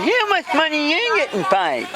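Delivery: very high, garbled character voice; joke character Vicky. Acted. Vicky asks her friend about pay, high garbled voice. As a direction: ask in a high, mangled put-on voice.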